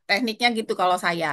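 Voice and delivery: normal talking voice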